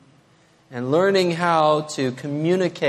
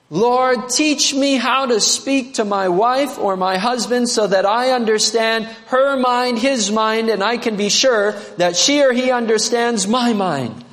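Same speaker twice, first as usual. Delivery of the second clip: raised, earnest prayer voice; 6 semitones higher than usual. Acted. Preacher praying aloud for couples, earnest prayer. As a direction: lift it up as a heartfelt prayer. Prays aloud within sermon.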